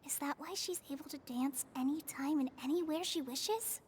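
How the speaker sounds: low, soft murmur, almost a whisper; hushed aside. Acted. Paimon murmurs privately about the dancer, hushed whisper. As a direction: muse quietly to yourself, half-whispering.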